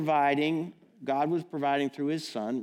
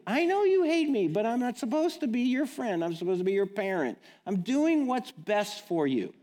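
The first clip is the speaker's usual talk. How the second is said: exasperated parent; 10 semitones higher, 4 dB louder than usual. Retold or imitated. Parent arguing with child.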